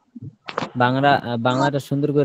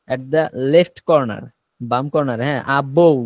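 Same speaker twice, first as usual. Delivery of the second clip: ordinary talk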